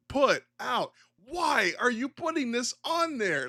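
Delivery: who on earth asked for this; incredulous outrage. Real, not acted. Ranting at Nintendo over the Virtual Boy, high and incredulous.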